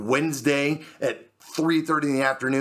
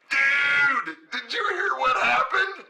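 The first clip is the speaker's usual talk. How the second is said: very high, gleeful shouting; over-the-top squealing excitement; excited client; 12 semitones higher, slower than usual. Retold or imitated. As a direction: imitate a client bursting with news. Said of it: "this is what I heard on the other end".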